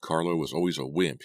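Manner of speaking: plain reading voice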